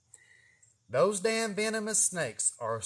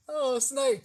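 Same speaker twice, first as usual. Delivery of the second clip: higher, light "oh a snake"; casual surprise; 9 semitones higher, slower than usual. Retold or imitated. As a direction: notice it calmly, mildly interested. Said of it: "you just go from".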